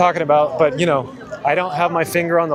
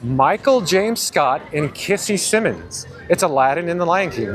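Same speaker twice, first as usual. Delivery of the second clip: ordinary talk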